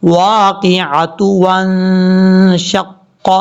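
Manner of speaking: واقعۃ read with ta and damma into وانشق; slow recitation; joined with damma. Shown as a demonstration. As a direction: link without stopping. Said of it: "پیش ہے پڑھا جائے گا".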